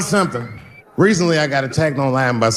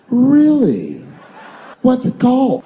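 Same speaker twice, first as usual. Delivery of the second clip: excited friend; 8 semitones higher, slower than usual. Retold or imitated. Listener reacting to pitch.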